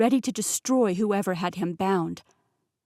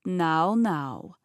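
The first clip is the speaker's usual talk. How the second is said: put-on Russian accent; 2 semitones lower, 3 dB louder than usual. A narrator's reading. Rasputin calming voice.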